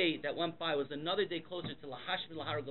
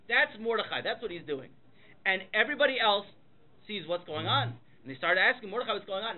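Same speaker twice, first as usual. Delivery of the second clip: ordinary talk, nothing marked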